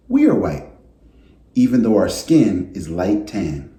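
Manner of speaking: gentle storybook read-aloud voice; reading to children. A narrator's reading. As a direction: read it like story time for a class. Teacher reads picture book aloud.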